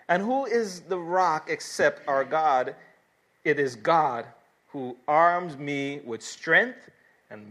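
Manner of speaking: loud, forceful pulpit reading; declamatory scripture reading. Real, not acted. Preacher reading of God's strength to his congregation, declamatory. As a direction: proclaim the verses, leaning hard on key words.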